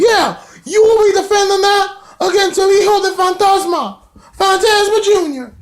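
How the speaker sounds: very high falsetto caricature; mocking Cornette impression. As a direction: proclaim it in a mock squeal. Retelling Cornette's lines in a wrestling recap.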